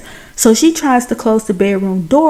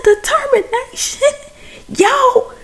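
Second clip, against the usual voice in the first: squeaky high-pitched laughing disbelief; real disbelief. Real, not acted. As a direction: shriek it half laughing in shock. Host reacts to plot twist.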